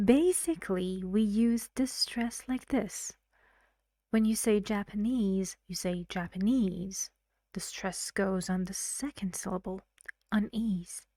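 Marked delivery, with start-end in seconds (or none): none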